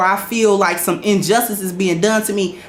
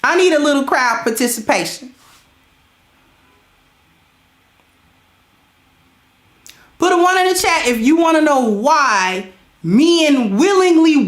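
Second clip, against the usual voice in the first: higher, slow, drawn-out sermon voice; preaching to the ladies; 5 semitones higher, slower than usual. Acted. Streamer lecturing the ladies in chat, slow higher preaching. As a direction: lay it down like a preacher, word by word.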